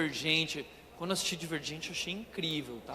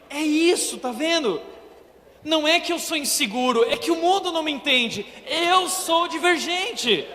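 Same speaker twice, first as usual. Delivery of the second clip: put-on proud teen; 8 semitones higher, 5 dB louder than usual. Teen after the movie proudly announcing he is divergent. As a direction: declare it like a revelation.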